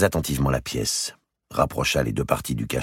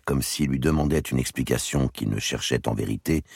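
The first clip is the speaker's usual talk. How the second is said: ordinary delivery, nothing marked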